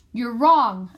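loud defiant shout; furious loud denial. A narrator's reading. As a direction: shout it back at her. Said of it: "I shout".

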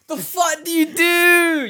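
very high, loud, slow "the fuck do you do"; exasperated wail. Pretend. Imagining Buddha's powers, high drawn-out exasperated wail. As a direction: stretch out mock despair. Mock despair at Buddha game.

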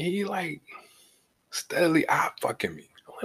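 ordinary delivery

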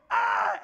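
loud high "Ah!"; short yell of alarm; panicked scream. Acted. Elijah fleeing the brush fire, acted-out scream.

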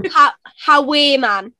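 "How, how are you, man?" as "ha, ha-WAY man", H sounded. Shown as a demonstration. Showing the learner, "How, how are you, man?" with H, as "ha-WAY man". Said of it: "there is a H in that one".

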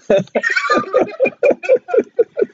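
long high-pitched laugh. Real, not acted. Laughing after teasing a friend about their accent, high giggling.

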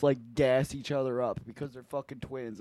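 ordinary delivery

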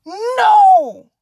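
sudden high loud cry of no; yelled no. Real, not acted. Yelling at the cat in the garbage, loud cry. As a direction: shout to stop the cat.

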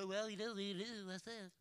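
soft, mumbled brush-off; half-voiced apathetic murmur, fading out; mock shrugging indifference. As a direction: toss it off softly, as if shrugging. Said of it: "And don't just go".

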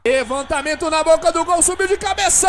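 fast build-up, then a high shout; rising excitement. Real, not acted. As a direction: call the header as it flies in. Build-up to a goal.

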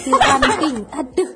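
finishing the phrase while laughing; cracking up at the silly line. Real, not acted. Mocking a friend's dramatic phrase, laughing.

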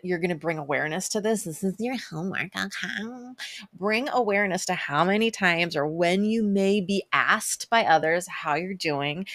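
ordinary talk

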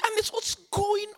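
very high, drawn-out wailing question; imitated bewilderment. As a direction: act out a hopelessly confused girlfriend. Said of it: "She's so confused".

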